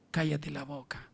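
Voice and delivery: a quiet firm inner voice; hushed command. Acted. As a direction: hush him from within. Holy Spirit rebukes the pastor.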